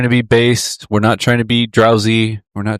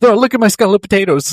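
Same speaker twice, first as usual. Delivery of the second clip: very high mock villager showing off; mock high Irish peasant; 11 semitones higher than usual. Voicing an old Irish carver showing a potato skull, pitched very high. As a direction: squeak it like a proud peasant.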